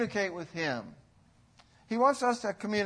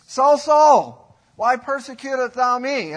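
the name called out much higher and louder; loud calling; 4 semitones higher, 9 dB louder, slower than usual. Shown as a demonstration. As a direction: summon him, loud and insistent. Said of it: "Why did he have to say it twice?".

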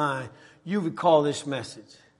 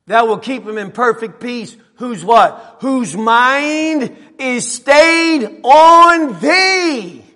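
raised voice, stretching the final words out loud; emphatic preaching; 7 semitones higher, 11 dB louder, much slower than usual. Real, not acted. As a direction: build the verse to a high, drawn-out ring. Preaches at full force.

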